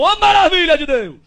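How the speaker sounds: slow, loud, high cry of praise; exultant shout from the shack; joyful shout. Retold or imitated. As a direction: shout it out from inside with delight. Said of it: "gritou lá de dentro".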